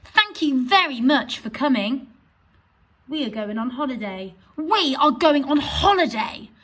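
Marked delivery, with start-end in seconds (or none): 0.0-2.1 louder with very stressed; exclaiming. Shown as a demonstration. Teacher contrasting a plain and an exclaimed thank you. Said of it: "express those sentences in a different way". 4.6-6.5 higher and louder, punched we; excited. Shown as a demonstration. Teacher reading the exclamation version with excitement. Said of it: "express those sentences in a different way".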